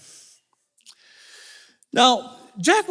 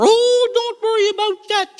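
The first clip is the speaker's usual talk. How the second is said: witch's high reassurance; 13 semitones higher, 3 dB louder than usual. Acted. Witch to worried Jack, high-pitched reassurance. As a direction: brush the worry off in a high voice. Witch soothes Jack's worry.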